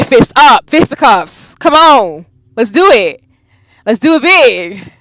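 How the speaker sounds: loud, high battle hype; slow rousing shout on big; hyped. Real, not acted. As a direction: pump yourself up for the fight. Hyping up for a fight.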